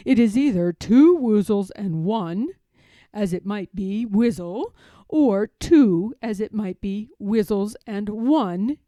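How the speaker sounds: a bear reasoning it out; Pooh voice. A narrator's reading.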